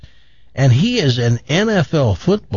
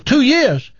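high, scoffing boastful Texan; bragging Texan; 8 semitones higher than usual. Acted. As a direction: scoff and brag like a big-talking Texan. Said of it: "He goes".

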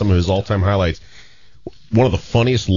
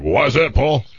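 Gene Okerlund impression; 6 semitones higher than usual. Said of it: "Gene's like".

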